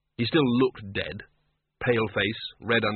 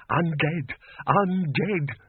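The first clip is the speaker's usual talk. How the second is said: coming unglued; 4 semitones higher, much slower than usual. A narrator's reading. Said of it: "The bursar was becoming a little unglued".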